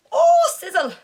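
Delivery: high loud squeal; shrill yelp. Real, not acted. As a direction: yelp at the burn. Reacts to drinking shot.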